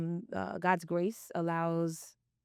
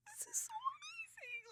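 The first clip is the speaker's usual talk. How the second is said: high, much softer tearful whisper; imitated teary whisper; 16 dB quieter than usual. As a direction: mimic yourself choking up at your kid's speech. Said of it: "Why am I crying?".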